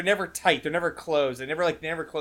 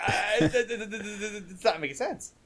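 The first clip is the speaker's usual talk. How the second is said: stammering in disbelief; 4 semitones higher, 4 dB quieter than usual. Retold or imitated. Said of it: "He's like".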